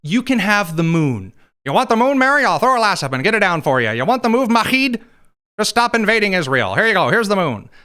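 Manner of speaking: lightly hammed-up Witkoff sweet-talking a foreign leader; mock Steve Witkoff. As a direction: dangle the moon like a salesman. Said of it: "Witkoff is really great at saying".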